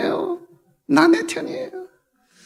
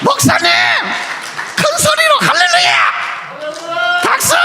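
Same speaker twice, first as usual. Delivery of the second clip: rousing shout. Real, not acted. Pastor urges the congregation to clap and laugh, shouting. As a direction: whip the crowd up with a yell.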